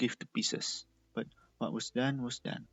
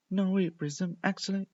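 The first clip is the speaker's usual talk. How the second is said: higher, louder, cutting in; urgent interruption; 8 semitones higher, 6 dB louder than usual. A narrator's reading. Candy cutting off Prism, urgent. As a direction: stop him, eager.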